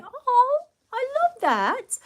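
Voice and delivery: very high, drawn-out coo; delighted gushing. Real, not acted. Vlogger loving a viewer's comment, gushing. Gushes over what a viewer wrote.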